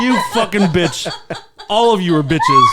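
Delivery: loud, laughing pretend yell; mock shout. Host yells at everyone in mock fury. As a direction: bark it at everyone in mock rage.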